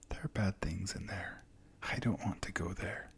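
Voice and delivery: scared, hushed whisper; frightened child whispering about bad things. A narrator's reading. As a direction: breathe it out, afraid of the well. Frightened girl refuses the well.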